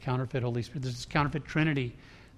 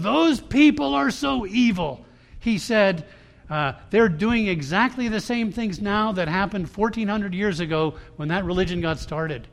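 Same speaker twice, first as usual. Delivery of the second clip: much louder and higher, frustrated; loud upset retelling; upset outburst; 6 semitones higher, 8 dB louder than usual. Retold or imitated. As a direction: voice the friend's anger loudly.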